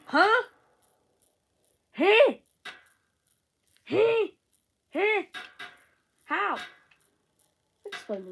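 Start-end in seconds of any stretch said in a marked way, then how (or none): none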